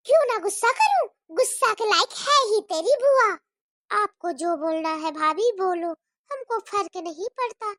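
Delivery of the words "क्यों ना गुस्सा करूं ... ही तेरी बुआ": higher, quick irritated retort; irritated snap. Acted. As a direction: snap back at the child. Bhabhi snaps back at child. (झुंझलाकर तेज़ जवाब)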